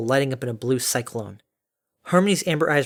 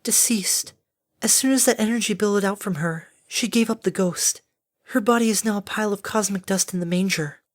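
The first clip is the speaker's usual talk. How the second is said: solemn Fluttershy; 7 semitones higher than usual. Acted. Fluttershy tells Harmony the Capricorn died, solemn. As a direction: report the death in a higher, restrained voice.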